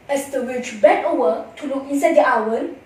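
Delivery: plain reading voice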